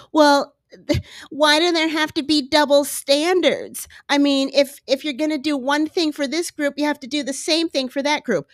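high-pitched imitation of an indignant objector; mock complaining. Voices double-standard complainers.

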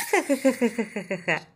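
happy tickled giggle; giggling. A narrator's reading. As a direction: laugh as if being tickled. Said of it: "me reí".

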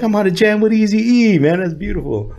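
high, thrilled disbelief; excited. Retold or imitated. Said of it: "And I'm like".

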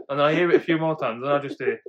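normal talking voice

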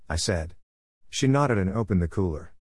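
usual speaking voice

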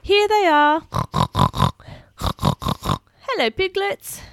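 animated picture-book reading; reading to children. Acted. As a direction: read it like bedtime for little ones. Reads picture book aloud.

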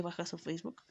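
hushed whisper of a juicy detail; whispered aside. Real, not acted. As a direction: whisper it like a secret. Spiteful aside.

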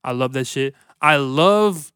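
usual speaking voice

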